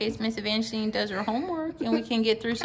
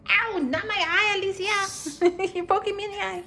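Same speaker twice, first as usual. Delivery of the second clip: startled ow; 9 semitones higher than usual. Real, not acted. Child poking mother's eye.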